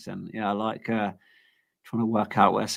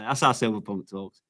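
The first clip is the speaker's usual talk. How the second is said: ordinary talk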